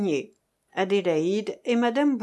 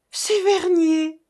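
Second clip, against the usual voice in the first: high, loud, drawn-out cry of recognition; acted excited outburst; exclaiming; 7 semitones higher, 5 dB louder, slower than usual. A narrator's reading. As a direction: cry out the name in sudden joy. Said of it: "s'écria-t-elle".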